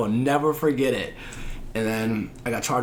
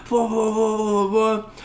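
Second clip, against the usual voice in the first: mock blah; 8 semitones higher than usual. Mocking the lecture.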